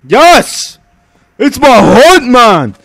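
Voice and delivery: loud, high elated cry; excited yell. Real, not acted. Gamer thrilled by a find, loud excited shout. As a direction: shout it in joy.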